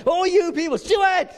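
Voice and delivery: playful shouted summons, pitch jumping up. Pretend. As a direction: call out like God summoning Stuart. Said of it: "just go".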